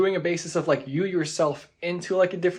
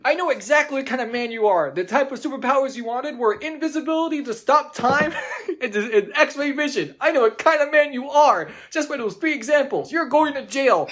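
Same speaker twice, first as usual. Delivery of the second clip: accusing prosecutor; 8 semitones higher than usual. Pretend. Mock prosecutor accuses a player.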